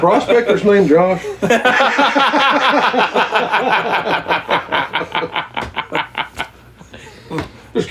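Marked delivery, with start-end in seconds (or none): 1.3-6.4 real, rapid-fire belly laugh that keeps going; long helpless laugh. Real, not acted. Listener cracking up at the prospector joke, helpless laughter.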